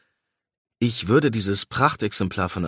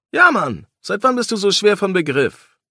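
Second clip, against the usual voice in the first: impatient retort; 9 semitones higher, 6 dB louder than usual. Acted.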